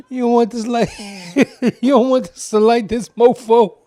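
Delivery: cracking up while imitating, high and loud; cool guy voice. Retelling the misheard film line, laughing cool guy voice.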